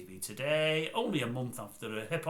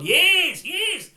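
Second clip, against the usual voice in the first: loud, high, stretched-out years; hammed-up loud years, years; exaggerated correction; 13 dB louder than usual. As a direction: overdo it for the laugh.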